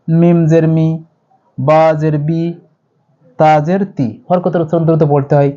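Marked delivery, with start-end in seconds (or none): 0.1-4.1 মি বি তি cut short and quick; quick short vowel. Shown as a demonstration. Teacher demonstrating the short vowel to students, quick. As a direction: clip each vowel short. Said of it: "কিন্তু এটি দ্রুত পড়বো".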